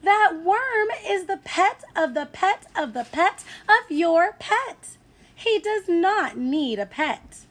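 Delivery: high, loud, fed-up mother; mother losing patience, voice up; exasperated mother. A narrator's reading. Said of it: "cried the boy's mother".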